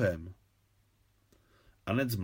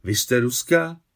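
a startled, louder question; astonished question; 6 dB louder than usual. A narrator's reading. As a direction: ask it in open amazement. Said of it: "zeptala se udiveně Anet".

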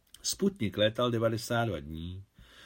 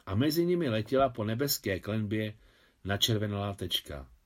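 plain reading voice

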